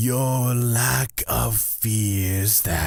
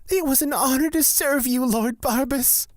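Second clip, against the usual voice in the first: much higher, fast fawning voice; fawning servant; much faster than usual. Acted. Servant thanking Lord Barbas, a high fast fawning voice. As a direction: gush the thanks quickly, eager to please.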